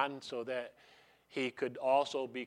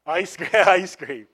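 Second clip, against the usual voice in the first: laughing, louder; laughing answer; 2 semitones higher, 10 dB louder than usual. Real, not acted. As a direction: toss off a playful laugh.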